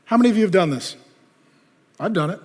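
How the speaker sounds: normal talking voice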